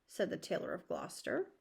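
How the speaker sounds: ordinary delivery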